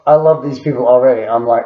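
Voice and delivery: ordinary talk, nothing marked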